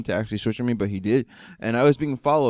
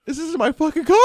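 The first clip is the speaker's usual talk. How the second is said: very high retold wail; hammed-up freak-out over a borrowed car; mock panicked wail; 4 dB louder than usual. Driver realizes he crashed his dad's car, mock panicked wail.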